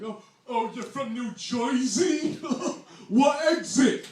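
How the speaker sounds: loud, hammed-up New Jersey accent; mock New Jersey accent. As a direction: tease in a broad New Jersey accent, rising high. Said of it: "make fun of our accident".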